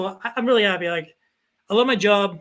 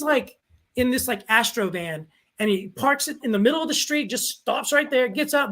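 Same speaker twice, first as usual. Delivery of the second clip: normal talking voice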